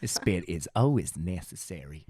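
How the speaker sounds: low, drawn-out comic impression; slow, deep celebrity send-up; Theo Von. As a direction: drop the pitch and stretch the words in imitation. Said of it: "We're not doing Theo Von anymore".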